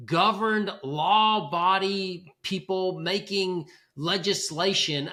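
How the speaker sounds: slow, loud and emphatic; emphatic rant. Real, not acted. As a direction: hammer each word home. Rant about crappy salesmanship.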